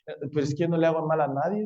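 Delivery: fast, offhand imitation of a self-justifying person; a light mock of people who say they harm no one; imitating excuse-maker. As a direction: toss off the excuse quickly. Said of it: "Usted ha oído a la gente".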